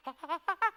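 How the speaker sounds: forced jolly laugh to show how it's done; hammed-up woodcutter chuckle; demonstration laugh. Goblin showing how his friends laugh, fake jolly laugh. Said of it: "like this".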